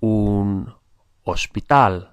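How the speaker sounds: "hospital" said the Spanish way with silent H; Spanish pronunciation. Shown as a demonstration. Teacher models words for learners to notice, Spanish pronunciation. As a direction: model "hospital" slowly, no H, ending stressed. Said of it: "pay attention to the pronunciation".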